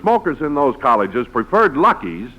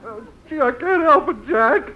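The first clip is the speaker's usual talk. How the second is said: choked-up, teary higher voice; crying; 11 semitones higher than usual. Acted. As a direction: blubber it out, overcome with emotion. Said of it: "Don, you're crying".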